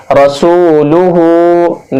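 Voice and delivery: "रसूलुहु" with सू and final हु held long; slow recitation, ra-soo-lu-hoo, in "रसूलुहु"; stretched long vowels. Shown as a demonstration. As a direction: keep र and लु brief, draw out the rest of "रसूलुहु". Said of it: "इनको भी खींचकर".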